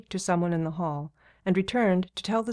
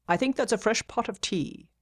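absent, half-listening, higher voice; distracted; 5 semitones higher than usual. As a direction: say it while busy with something else. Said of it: "Mabon said distractedly".

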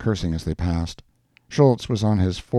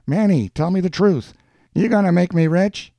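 raised, higher-pitched teasing call down the street; 11 semitones higher, 4 dB louder than usual. A narrator's reading. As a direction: call out high to the postman. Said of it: "he called out".

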